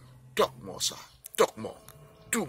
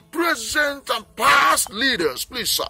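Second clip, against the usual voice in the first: slow, raised-voice appeal to the leaders; calling out slowly, stretching each word, pleading; impassioned call; 6 semitones higher, 4 dB louder, slower than usual. Real, not acted. Speaker pleading with present and past leaders, loud and slow.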